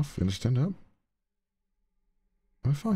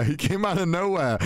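usual speaking voice

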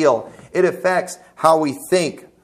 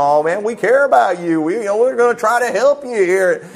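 hammed-up friendly accent, oozing concern; Doctor Phil impression; 3 semitones higher than usual. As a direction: badly imitate a folksy, caring TV counsellor. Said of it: "he's got that friendly accent".

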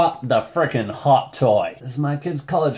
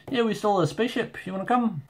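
put-on Korg's casual accent; 8 semitones higher, 4 dB quieter, faster than usual. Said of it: "I'm not good at accents".

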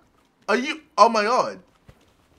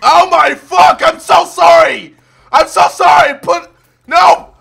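panicked shouting; 4 semitones higher, 8 dB louder than usual. Real, not acted. Panicked apologies in game.

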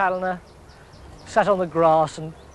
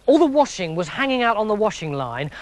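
plain reading voice

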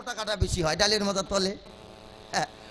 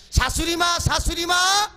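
calling out; 4 semitones higher, 4 dB louder than usual. Acted. Bride calling at the window.

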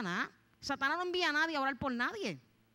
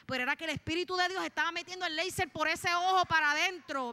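raised, impassioned testimony building in force; fervent preaching; 4 semitones higher than usual. Real, not acted. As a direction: drive it home with preacher's fervour.

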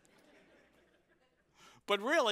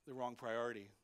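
gentle correction; 7 semitones lower, 6 dB quieter, faster than usual. Retold or imitated. Jesus gently correcting Martha, soft and low. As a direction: lower and softer, kind correction. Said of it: "He's gently telling Martha".

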